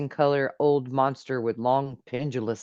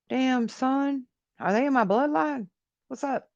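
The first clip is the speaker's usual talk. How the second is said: much higher, playful exclamation; excited high voice; 12 semitones higher than usual. Real, not acted. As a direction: hype yourself up, jokingly. Joking excitedly about his bloodline.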